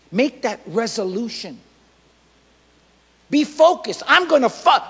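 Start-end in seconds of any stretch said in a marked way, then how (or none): 3.3-4.9 loud, high preacher's command; forceful exhortation. Real, not acted. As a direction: punch it out to the congregation.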